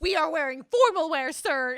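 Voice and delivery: loud, pompous protest; mock indignation. Podcaster hams up Shane's indignation, loud and pompous. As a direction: huff it out like an offended gentleman.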